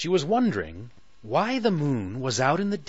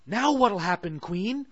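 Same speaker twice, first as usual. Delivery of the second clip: worried Sally; 10 semitones higher than usual. Acted. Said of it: "Sally asked with worry".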